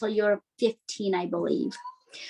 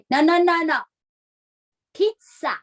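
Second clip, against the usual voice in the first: louder, higher, firm correction; correcting mom; 6 semitones higher, 5 dB louder than usual. Retold or imitated. Mom correcting her daughter's pronunciation, firm and louder. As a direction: mom stressing pizza properly. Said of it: "And she would say".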